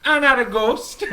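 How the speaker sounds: high, loud mock indignation; indignant protest. Visitor objecting to kids calling him ghost, indignant.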